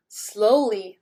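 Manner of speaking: ordinary delivery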